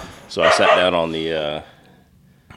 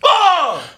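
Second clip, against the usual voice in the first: very high, sudden shriek; imitated kid's yell; 4 dB louder than usual. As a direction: shriek like a kid at a game. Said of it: "all I hear from, like, three rooms away is".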